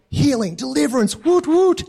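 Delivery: high-pitched, hammed-up celebration; mock cheering. Church reacting to a deliverance, mock excited cheering. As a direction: whoop it up like an excited congregation. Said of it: "And we would be like".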